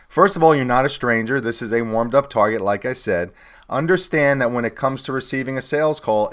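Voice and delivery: ordinary delivery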